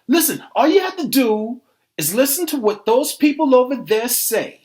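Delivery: loud, insistent instruction; heated lecturing. Acted. Arguer lecturing a doubter, loud and insistent. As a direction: lay it down forcefully at normal pace.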